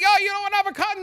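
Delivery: high-pitched mock sales question; imitated pushy cashier. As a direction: play the cashier piping up with an offer. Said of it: "And the guy's like".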